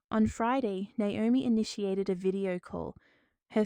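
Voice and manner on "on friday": ordinary delivery, nothing marked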